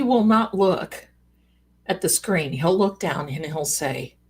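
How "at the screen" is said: ordinary talk, nothing marked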